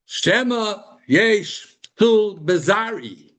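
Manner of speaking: worried musing. Retold or imitated. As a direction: let the worry stretch it out. Yaakov's worry voiced.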